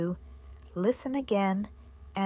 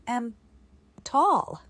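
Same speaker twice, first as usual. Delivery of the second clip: dictating; 4 semitones higher, 5 dB louder than usual. Shown as a demonstration. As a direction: read it out for students to write. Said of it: "I repeat".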